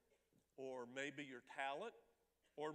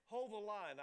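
excited; 6 semitones higher, 6 dB louder than usual. Retold or imitated. Receptionist eager at big donation.

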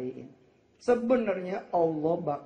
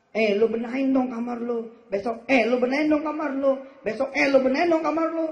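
nagging mother, rising higher and louder each time; nagging parent; 9 semitones higher, 7 dB louder than usual. Retold or imitated. Mother nagging child to tidy room, growing higher and louder. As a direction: scold the kid louder with every repeat. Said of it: "Tiga kali aja ente ngomong".

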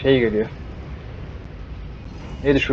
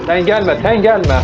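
raised, higher urgent shout of sen gelme; yelling at zombies; 7 semitones higher, 4 dB louder, slower than usual. Real, not acted. Gamer yelling at zombies to stay back, a raised shout. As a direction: push them back with a sharp yell of gelme.

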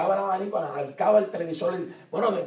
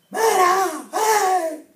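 high mock bark of a yelling father; father yelling at son; 14 semitones higher, 6 dB louder, much slower than usual. Preacher mimics a father yelling at his son, like herding a cow. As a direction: bellow it like driving cattle. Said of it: "como si estuviera cochando una vaca".

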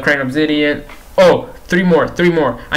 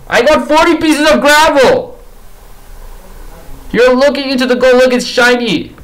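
high, loud disbelieving outburst; exasperated yell; 7 semitones higher, 3 dB louder than usual. Real, not acted. Gamer yelling at piglin over worthless gravel trade.

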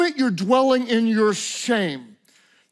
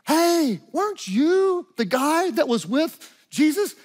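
put-on higher, slow little-girl question; lightly voiced little girl, pitched up; 6 semitones higher, slower than usual. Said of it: "a little girl walks up and says".

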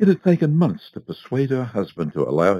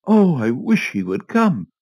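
longing wish; 8 semitones higher, 5 dB louder than usual. A narrator's reading. Wife longing for her husband.

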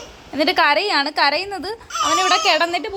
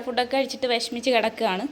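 ordinary talk

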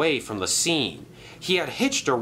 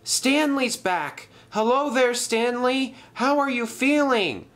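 high-pitched, delighted hailing; excited greeting; 11 semitones higher than usual. Acted. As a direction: call down happily to a returned friend. Ryan greets returned friend from above.

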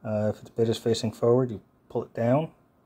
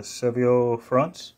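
French accent; 2 semitones higher, 5 dB louder, much slower than usual. Shown as a demonstration. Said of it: "my French accent is pretty horrible".

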